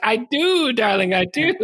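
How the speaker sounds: high, swooping boast imitating a cocky friend; retold cocky comeback, pitched high; mock insistence. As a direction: insist playfully, voice leaping up at the start. Quoting Colin's insistent comeback.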